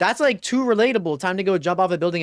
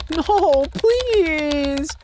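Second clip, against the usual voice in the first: high, pleading whine; high desperate plea; 9 semitones higher than usual. Real, not acted. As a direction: beg the screen not to. Begging the game.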